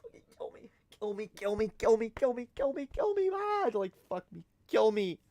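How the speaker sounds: whispered start rising to high desperate pleading; inner despair. Retold or imitated. Embarrassed son at the bar begging to die, rising wail. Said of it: "I'm just sitting here like".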